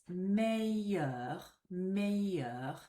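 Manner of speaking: meilleur as MAY-yur, no L sound; French pronunciation model. Shown as a demonstration. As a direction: say meilleur slowly in two syllables, may-YUR, with no L. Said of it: "if you pronounce it like this".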